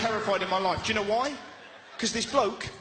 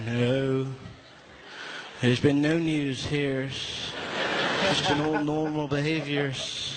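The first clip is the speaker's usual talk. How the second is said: fake casual; 6 semitones lower, slower than usual. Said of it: "She's like".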